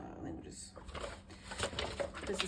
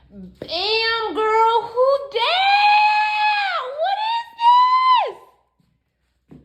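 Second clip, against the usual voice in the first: loud, high hype squeal; excited showing-off; 11 dB louder, slower than usual. Real, not acted. Showing viewers the stroller, excited squeal. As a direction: hype it up like a big reveal.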